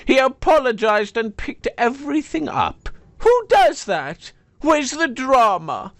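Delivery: high, hearty mock outrage; teasing wife. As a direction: boom out fond exasperation. Wife mocks husband fondly.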